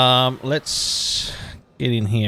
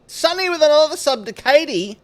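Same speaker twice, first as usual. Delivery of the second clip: excited; 6 dB louder than usual. Real, not acted. Announcing a new sub.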